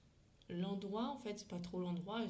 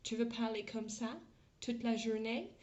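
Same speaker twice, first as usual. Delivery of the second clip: French with a put-on English accent; mock English accent in French; 3 semitones higher, 3 dB louder than usual. Shown as a demonstration. Teacher challenging critics of accents, mock English accent. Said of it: "parler français avec un accent anglais".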